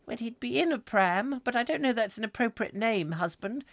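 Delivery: high prim wife voice. Acted. Wife answering husband about baby, high prim voice. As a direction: pitch it up as the fussing wife.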